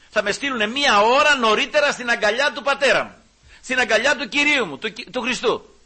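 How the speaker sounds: loud, high, impassioned sermon cry; fervent preaching. Real, not acted. Preacher crying out to the congregation, loud and fervent. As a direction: proclaim it with full conviction.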